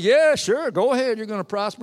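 high, sarcastic fake encouragement; mocking agreement. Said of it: "he's mocking him".

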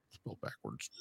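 secretive mock cult whisper. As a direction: breathe it like a secret password. Mock cultist repeats the phrase.

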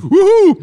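a high, loud Wuhu, whooped like a cheer; whooping cheer. Real, not acted. Host cheers to lead into the next topic, whooping loud and high. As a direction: whoop it out like a party call.

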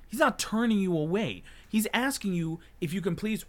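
loud, high retold scolding; re-enacted angry correction; indignant comeback. Retold argument with rude customer.

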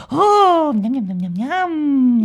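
loud swooping oh into yummy munching; greedy delight. Acted. Unicorn spotting a dead mouse to eat, greedy delight. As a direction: swoop up, then gobble the words hungrily.

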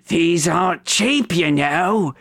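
high-pitched goblin grumble; hunchback goblin. Acted. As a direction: put on a high goblin voice, haggling. Goblin Tombford voiced by game master.

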